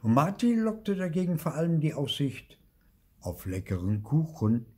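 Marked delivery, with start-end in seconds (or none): none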